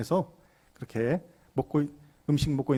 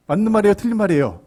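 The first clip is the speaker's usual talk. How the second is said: louder, higher, pressing repeat; insistent question; 6 semitones higher, 6 dB louder, faster than usual. Real, not acted. Preacher asking congregation right or wrong, insistently. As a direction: push the listeners hard for an answer.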